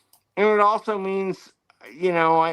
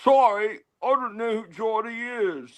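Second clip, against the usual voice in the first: raised-pitch stab at a Geordie accent; attempted Geordie accent; 5 semitones higher than usual. Shown as a demonstration. Host trying out an accent on request, attempted Geordie. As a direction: have a rough go at Geordie, higher pitched. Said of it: "Tell me, I'll try it".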